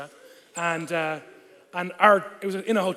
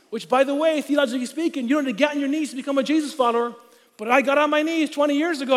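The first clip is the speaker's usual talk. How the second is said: ordinary delivery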